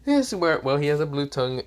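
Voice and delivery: usual speaking voice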